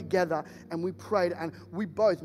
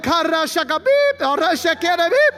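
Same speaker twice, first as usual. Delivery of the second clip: loud, high babbling tongues; loud gibberish tongues; mock speaking in tongues; 10 semitones higher, 12 dB louder than usual. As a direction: ham up tongues with a bleeped swear. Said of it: "mixing swearing in with his tongues".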